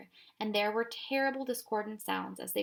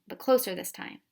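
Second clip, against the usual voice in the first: ordinary delivery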